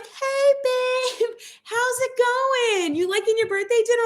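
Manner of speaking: high, sing-song breezy greeting; cheerful husband. Retold or imitated. Husband answers the phone cheerfully.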